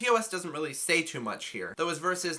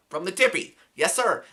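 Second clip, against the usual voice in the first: loud, raised rap shout-out; 4 semitones higher, 7 dB louder than usual. Retold or imitated. Reviewer imitating a guest rapper's entrance, loud ad-lib. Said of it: "And he comes in".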